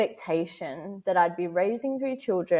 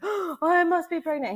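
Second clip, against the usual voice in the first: higher, breathless mock alarm; mock dramatic gasp; 10 semitones higher than usual. Wife imitating herself thinking she's pregnant, mock gasp. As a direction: gasp it like a sudden realisation.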